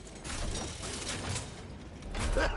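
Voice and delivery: real laugh. Real, not acted. Laughs at the villain's fate.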